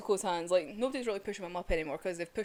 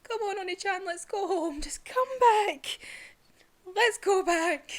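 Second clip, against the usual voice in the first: much higher and louder, begging her brother home; pleading little sister; 10 semitones higher, 6 dB louder than usual. Retold or imitated. Nezuko calling her brother back home, high pleading. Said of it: "and it's like".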